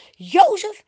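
high, loud insistent call; calling him back. A narrator's reading. Potifar's wife grabs Jozef's cloak, calling him back sharply. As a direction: call after someone walking off. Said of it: "ze roept hem terug".

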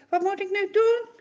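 begging for help; anxious prayer. Acted. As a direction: Moses praying in fear of stoning. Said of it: "bidt Mozes".

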